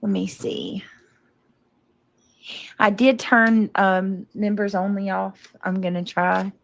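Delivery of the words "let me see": whispered aside to herself. Real, not acted. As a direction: breathe it out under your breath. Checking settings, aside.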